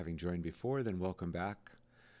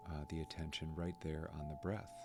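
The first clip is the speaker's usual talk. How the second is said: soft, unhurried meditation voice with long pauses; guided meditation; 7 dB quieter than usual. Acted. Guide leads listeners to notice their breath, soft guided meditation. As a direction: ease them gently onto the breath, leaving space.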